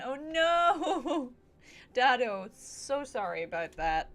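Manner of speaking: ordinary talk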